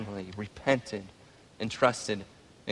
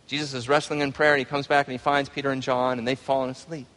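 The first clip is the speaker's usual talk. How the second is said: normal talking voice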